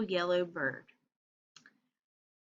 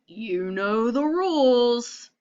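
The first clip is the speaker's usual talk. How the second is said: slow, louder stern cat; a hint of strict cat laying down the law; 6 dB louder, slower than usual. Mister Cat telling the frog off, slow and stern. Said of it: "And he says".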